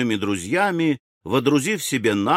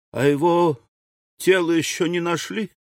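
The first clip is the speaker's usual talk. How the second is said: higher-pitched widow's question; вдова спрашивает; 7 semitones higher than usual. A narrator's reading. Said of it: "справилась вдова". (вопрос вдовы высоким голосом)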